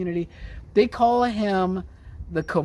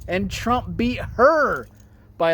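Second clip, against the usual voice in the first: normal talking voice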